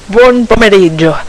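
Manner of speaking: "Buon pomeriggio" said with Italian vowels and a rolled r, loud and lifted; Italian greeting. Shown as a demonstration. Vlogger greeting viewers in Italian: "Buon pomeriggio" with real Italian sounds, loud. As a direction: give "Buon pomeriggio" the full Italian pronunciation, each vowel open and pure. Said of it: "I would say in Italian".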